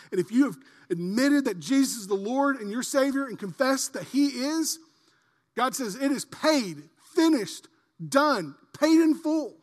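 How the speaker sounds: loud, high climactic proclamation; full-force preaching. Acted. Pastor proclaiming the debt is paid, loud preaching. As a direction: proclaim it to the rafters.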